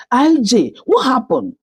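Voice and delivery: high-pitched, distressed cry. A narrator's reading. Mother to injured daughter at the hospital, crying out. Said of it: "she cried out and said".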